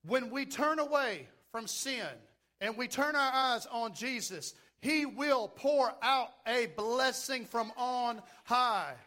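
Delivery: rising preacher's fervor; preaching. Acted. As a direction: preach it with building force. Preaching at full force.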